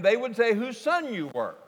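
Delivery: ordinary talk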